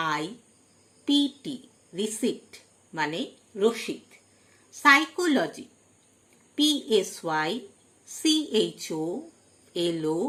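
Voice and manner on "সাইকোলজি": "সাইকোলজি" starting straight on S; silent P. Shown as a demonstration. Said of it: "পি silent". ("সাইকোলজি", শুরুতে পি উচ্চারণ না করে)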